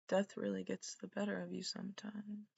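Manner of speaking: a whispered line, soft and ominous; mock spooky whisper. Whispering a grim joke to viewers, a mock spooky whisper. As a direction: breathe it out like a ghost story. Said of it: "Just kidding".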